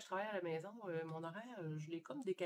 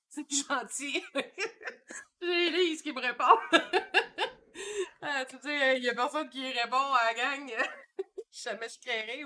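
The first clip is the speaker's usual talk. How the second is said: talking through loud, high-pitched laughter; real laughing; 4 semitones higher, 9 dB louder than usual. Real, not acted. Streamer to viewers as only Lise answers, laughing. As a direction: crack up mid-sentence, voice jumping up.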